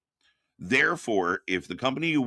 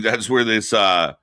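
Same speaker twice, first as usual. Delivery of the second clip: ordinary delivery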